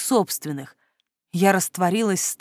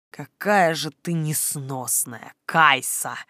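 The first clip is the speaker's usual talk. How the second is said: low, slow, hushed exasperation; exasperated reproach; 4 semitones lower, 4 dB quieter, slower than usual. A narrator's reading. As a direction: reproach her softly, drawing it out. Edrian reproaches the narrator, head shaking.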